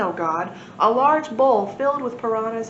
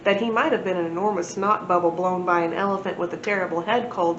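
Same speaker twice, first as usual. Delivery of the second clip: ordinary delivery, nothing marked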